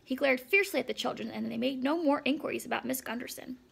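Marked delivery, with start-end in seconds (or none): none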